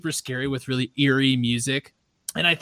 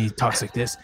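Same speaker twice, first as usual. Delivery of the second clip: low mock podcaster voice; true crime voice; 5 semitones lower than usual. Shown as a demonstration. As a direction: imitate a host right up on the mic. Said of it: "gets really close to the mic".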